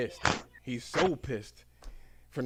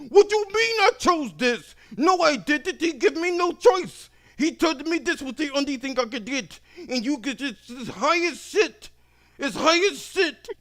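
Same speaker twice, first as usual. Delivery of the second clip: much higher, louder, indignant shouting; furious customer; 14 semitones higher, 8 dB louder than usual. Retold or imitated. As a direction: shout the complaint in disbelief. Customer complains.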